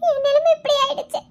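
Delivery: high, slow crying lament; tearful despair. Acted. Despairing girl alone, fears pregnancy. (விரக்தியில் அழுது புலம்பல்)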